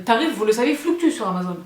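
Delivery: ordinary delivery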